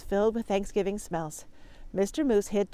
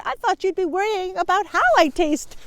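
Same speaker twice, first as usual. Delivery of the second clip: high, louder relieved turkey; 10 semitones higher, 6 dB louder than usual. Acted. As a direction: joke with nervous relief. Turkey's joke.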